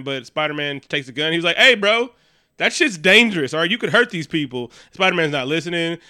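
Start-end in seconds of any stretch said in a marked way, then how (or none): 1.6-4.7 mock warning. Spider-Man warning arms dealers.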